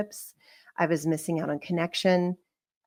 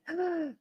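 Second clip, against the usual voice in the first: sharp gasp; 11 semitones higher, 6 dB quieter than usual. Retold or imitated. Shocked thought about "your truth.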